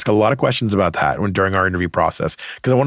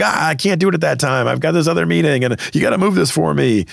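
mildly frantic, higher-pitched excuse; a touch of mock panic, pitched up; 6 semitones higher than usual. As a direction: act out a harried, overbooked interviewer. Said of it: "they're frantic and they're like".

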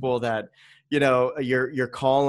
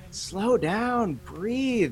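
higher, soothing slow down; calming advice; 9 semitones higher, much slower than usual. Retold or imitated. Parent of three telling college students to relax, soothing. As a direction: ease a stressed student down.

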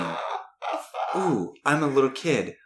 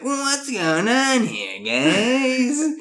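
higher, slow easygoing coach; folksy coach voice; 11 semitones higher, much slower than usual. Retold or imitated. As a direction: saunter in as a relaxed coach. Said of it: "coach is like".

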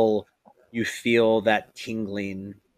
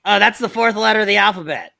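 high, loud, triumphant dimwit; dim character's realization; 10 semitones higher, 11 dB louder than usual. Acted. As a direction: announce it like a proud simpleton. Confused character proudly works out the letter.

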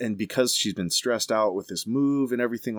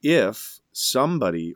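normal talking voice

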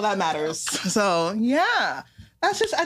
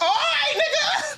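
mock shout; slower than usual. Brushing off a date's stay blessed, mock laughing shout. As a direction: yell it back with a laugh. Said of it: "I was like".

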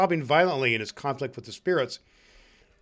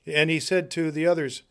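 usual speaking voice

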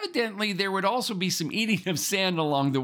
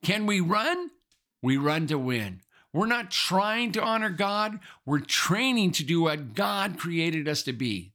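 ordinary talk, nothing marked

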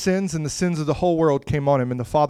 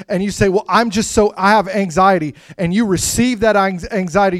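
raised, driving sermon voice; fervent preaching; 4 semitones higher, 3 dB louder than usual. Acted. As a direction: press the point hard to the congregation. Urgent preaching passage.

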